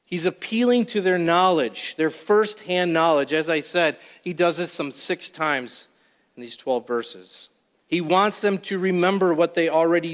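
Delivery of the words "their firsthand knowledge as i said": normal talking voice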